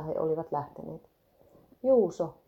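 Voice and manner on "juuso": louder, higher call of the name. A narrator's reading. Karlo greeting Taunon poika with relief, louder call. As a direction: hail him across the shore. Calling to the rescuer.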